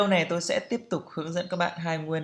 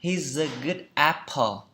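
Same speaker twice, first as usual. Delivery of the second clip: slow model sentence; slower than usual. Shown as a demonstration. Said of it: "good apple tức là".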